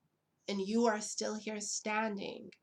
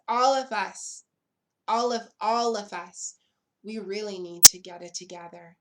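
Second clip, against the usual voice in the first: louder and louder, pleading for unity; building to a shout; 10 dB louder than usual. Real, not acted. Urging the whole LGBT community to unite, building to a shout. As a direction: build the appeal until you shout it.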